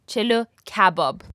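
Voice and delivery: "chelo kebab" with "kebab" stressed on its first syllable; Persian-style "chelo kebab", opening syllable of "kebab" emphasized. Shown as a demonstration. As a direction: model "chelo kebab" for learners, hitting the start of "kebab". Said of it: "emphasis is on the first syllable".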